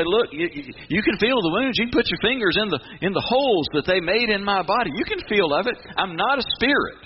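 high, insistent voiced Jesus; Jesus pleading. Preacher voicing Jesus to doubting disciples, high and insistent. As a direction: urge them to feel the wounds, pitched high and pressing. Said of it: "He'd say".

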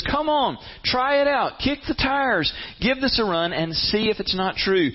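high, eager come on, try it out; playful dare. Acted. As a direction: coax them like a friendly challenger. Voicing God's playful dare.

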